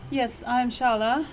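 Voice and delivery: ordinary talk, nothing marked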